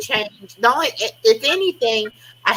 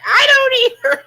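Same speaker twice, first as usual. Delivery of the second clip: exasperated yelp; 4 dB louder, faster than usual. Retold or imitated. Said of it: "I'm like".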